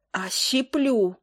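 plain reading voice

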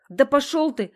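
high, loud outburst; angry dismissal. A narrator's reading. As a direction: send him packing furiously. Girl tells him to get lost.

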